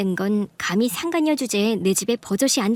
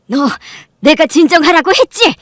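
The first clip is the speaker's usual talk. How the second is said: very loud, raised reprimand; sharp scolding; 6 semitones higher, 10 dB louder than usual. Acted. Mother ordering her daughter to calm down, scolding.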